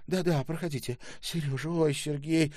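high, loud, emotional welcome; flustered, overwhelmed. A narrator's reading. Overwhelmed mother to visitors and returned son, flustered. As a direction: usher them in while still overcome.

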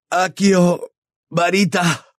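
high, urgent incantation; desperate spell cry. A narrator's reading. Trapped boy summons his wand, desperate high cry.

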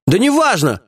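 high, eager dismissal; enthused. A narrator's reading. Lieutenant sees the boss soften, suddenly enthused. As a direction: brush off the worry with sudden keenness. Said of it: "воодушевился Чеченя".

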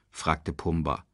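usual speaking voice

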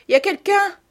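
put-on high, timid child call; hiding child. A narrator's reading. Child hiding in the toilet answers, high timid call.

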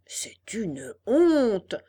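very drawn-out, swelling outrage; indignant fox. Acted. Fox reacts to the hanging, hammed-up indignation. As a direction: stretch the key word out in theatrical indignation.

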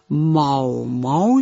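a slow, drawn-out calf moo; imitated moo. A narrator's reading. Calf mooing happily at mouse's offer, slow moo. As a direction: moo like a happy little calf.

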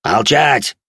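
loud, high alien shout. Acted. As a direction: shout it to silence him. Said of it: "крикнул иной".

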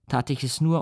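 plain reading voice

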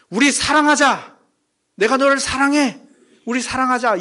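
high-pitched, direct declaration of love. Acted. God speaking love to His people, direct. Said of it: "하나님의 그 단도직입적인 말이".

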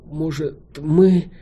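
gasping out the words; short of breath after a beating. Acted. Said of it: "с трудом выдохнула Файна". (задыхаясь)

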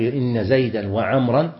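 زيدا وعمرا slowly, both ending in "-an"; accusative endings. Shown as a demonstration. Teacher explaining what must be said, accusative زيدا وعمرا with "-an" endings. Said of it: "بالنصب".

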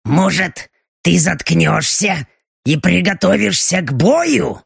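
otherworldly voice, dead serious snap; Belochka's grim demon bark. Acted. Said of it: "Удручающе серьёзно рявкнула Белочка".

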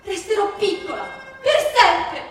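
put-on a little girl yelling she will stay small forever; defiant little girl. A narrator's reading. Teresina shouts at her mother that she won't grow up, high and loud.

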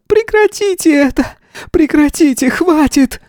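very high, pleading cries; acted screaming. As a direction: cry out desperately to make it stop. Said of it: "закричала Лариса".

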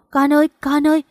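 alarmed calling. A narrator's reading. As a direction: call to your child with some alarm. Mother calls to distressed child. (hốt hoảng gọi con)

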